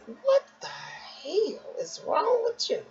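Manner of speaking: high, slow, aghast at herself in the mirror; stunned at what she sees, drawn out; shocked disbelief. Retold or imitated. Shocked at own reflection.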